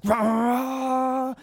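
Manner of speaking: high, hammed-up racer growl; imitating an intense driver revving up; mock growl. Competitive driver grunting.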